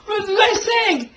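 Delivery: high, shouted protest; defensive outburst. Retold or imitated. Son dodging his father's questions, shouting high and defensive. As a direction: blurt it out high, caught off guard.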